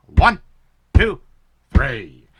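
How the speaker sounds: loud, punched referee three-count. Acted. Newsreader mimicking a wrestling ref, loud count. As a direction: slam each number like a ring count.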